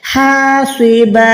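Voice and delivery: slow recitation, long held आ in हा and बा; drawn-out vowels. Shown as a demonstration. Teacher showing students the stop on two zabar, long-vowel recitation. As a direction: recite हा स्वे बा slowly, closing on an open long बा. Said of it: "बा ज़बर बा हो जाएगा".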